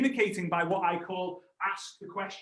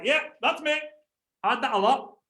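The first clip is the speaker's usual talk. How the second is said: higher mock confession; imitating backstage guys; 6 semitones higher than usual.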